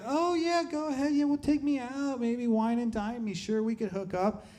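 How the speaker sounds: put-on high-pitched girl voice, easing down; mock easygoing girl. Imitates an easygoing younger girl.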